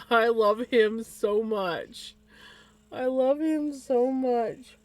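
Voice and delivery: slow, gushing pet talk; doting coo. Real, not acted. Owner cooing at her beloved pet, slow and doting. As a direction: melt over the pet, drawn out.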